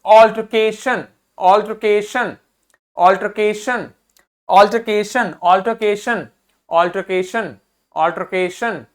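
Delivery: Altercation with a strong के, light शन; stress on के. Shown as a demonstration. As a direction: drill altercation, hitting के each time. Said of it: "इस पे जोर देना है".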